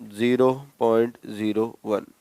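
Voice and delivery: slow and soft, each word spaced for students to write zero point zero one; slow dictation. Shown as a demonstration. Teacher slowly dictating the answer to his class. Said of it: "यानी कितना?".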